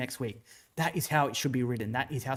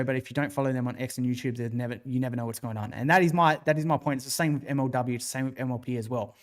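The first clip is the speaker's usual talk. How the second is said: ordinary talk, nothing marked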